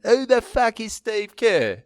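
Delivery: slow, high English accent imitation; put-on English accent, drawn out. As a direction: mimic the baffled English stranger. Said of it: "in an English accent".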